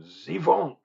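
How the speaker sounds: a spontaneous mock French Zivank; French accent. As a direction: Zivank said the French way as zee-VONK, nasal on the last vowel. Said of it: "I don't know why I said that in a French accent".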